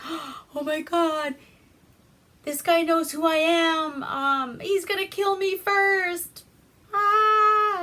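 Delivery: high mock panic building to a scream; freaked out. Lamp Guy telling the men he's next, hammed-up panic. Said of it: "freaked out".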